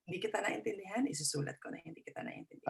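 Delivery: very soft mutter, fading into a whisper; hushed aside. Acted. Examiner privately noting a confused candidate, hushed mutter. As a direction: mutter it under your breath.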